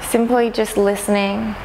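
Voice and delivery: calm, unhurried meditation guide, long pauses between phrases; guided meditation. Acted. Teacher inviting listeners to notice sounds around them, calm guided meditation. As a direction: gently lead them inward, leaving space after each phrase.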